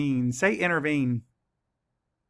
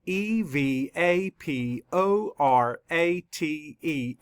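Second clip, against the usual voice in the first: slow, letter-by-letter spelling; measured classroom spelling; spelling aloud; much slower than usual. Shown as a demonstration. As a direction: spell it out slowly, each letter separate. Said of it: "Let's spell it".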